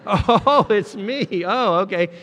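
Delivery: high, loud laughing punchline; cracking up at his own joke. Real, not acted. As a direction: laugh through the line.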